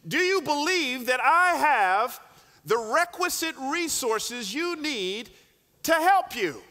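slow, loud, high voice from above; booming heavenly shout. Acted. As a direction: call down to him, slow and insistent. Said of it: "came shouting back".

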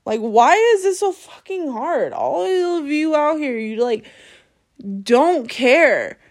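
usual speaking voice

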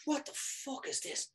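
breathless whispered disbelief; whispered aside. Retold or imitated. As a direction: hiss it under your breath in dismay. Bewildered aside to himself.

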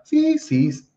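fisis very slow, stress on the first syllable; Greek pronunciation. Shown as a demonstration. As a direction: fisis spelled out slowly, Greek style. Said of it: "así sería la pronunciación".

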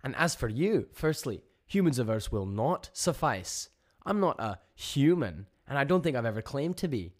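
loud, high-pitched telling-off; indignant. A narrator's reading. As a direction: set them straight, indignant and emphatic. Joni angrily confronts the alien voice.

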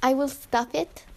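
halting, deadpan put-on Russian accent. Tattoo artist explaining pet pig practice, put-on Russian accent. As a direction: search for words in a mock Russian accent. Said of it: "I'm gonna do my Russian accent now".

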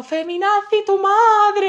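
high, loud shouted retort; furious comeback. Retold or imitated. Andrea shouting back at the heckler, high loud. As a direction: throw it right back, shouting. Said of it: "Y ahí empezó".

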